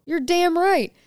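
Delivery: high, hard mock snap; defiant retort. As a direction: throw it back at her.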